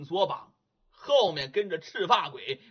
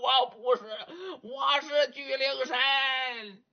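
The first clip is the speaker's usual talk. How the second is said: mimicked ghost cry; 6 semitones higher, slower than usual.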